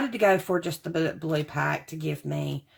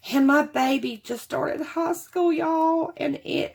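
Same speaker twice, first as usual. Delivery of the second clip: emotional; 8 semitones higher than usual. Real, not acted. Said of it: "I have been so emotional".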